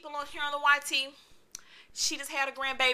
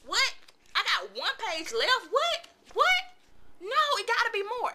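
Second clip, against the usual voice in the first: high, squeaky repeated "What?"; disbelief at the ending; 10 semitones higher than usual. Retold or imitated. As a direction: reenact shock at finding one page left. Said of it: "I'm like".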